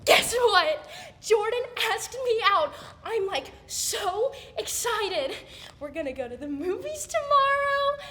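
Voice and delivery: loud, high burst of big news; gushing excitement. Acted. Teen announcing Jordan asked her out, gushing. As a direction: build to a high squeal on the last words.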